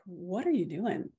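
normal talking voice

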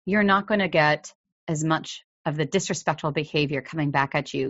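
ordinary talk, nothing marked

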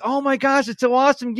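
high, over-the-top fake enthusiasm; mock gushing. Host imitates people raving about Venice, high mock gush. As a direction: ham up a starstruck tourist.

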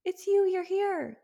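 high, cooing mock delight; put-on high-pitched awe; mock gushing recognition. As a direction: imitate a mother gushing over her newborn. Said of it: "instantly know who she was".

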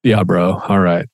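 lightly voiced teenage son; a dad mimicking his son's casual reply; imitating his son. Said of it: "That's bro everything".

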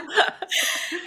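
short unvoiced laugh; quick airy chuckle; breathy laugh. Real, not acted. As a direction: let out a soft breathy laugh. Laughing at own joke.

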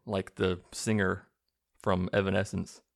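normal talking voice

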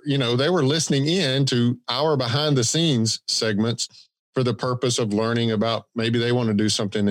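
ordinary talk, nothing marked

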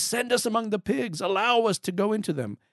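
high, pleading voice at normal volume; begging demons. Acted. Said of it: "The demons begged Jesus".

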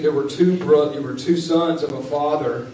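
usual speaking voice

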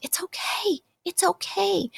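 a mock soothing hush; hushing reassurance. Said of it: "and we're just like".

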